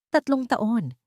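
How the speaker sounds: plain reading voice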